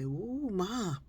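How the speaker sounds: a hint of a kid buttering up his mother; brother sweet-talking. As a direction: brother coaxing Mom for pie, light sugary flattery. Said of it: "maybe my brother would say".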